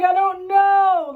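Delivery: high, slow, loud crying voice; tearful. Retold or imitated. Daughter crying to her mother on the phone, high and slow. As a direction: drag it out as if sobbing.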